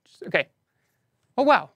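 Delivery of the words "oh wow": real surprise, higher and louder; surprised oh wow. Real, not acted. Host hearing maintenance moved earlier, genuinely surprised. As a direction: caught off guard by the news.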